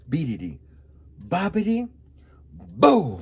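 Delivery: chanted spell, rising on bobbity, loud boo; magic spell. Pretend. To her best friend, a chanted fairy-godmother spell. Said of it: "when I say the magical words".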